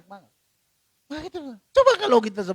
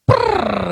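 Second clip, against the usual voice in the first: a louder rolled lip trill; mock eardrum pop, a louder lip trill; imitated popping noise; 6 dB louder than usual. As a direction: buzz the lips like a bursting eardrum. Eardrum bursting from phone calls.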